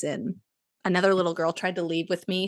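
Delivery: usual speaking voice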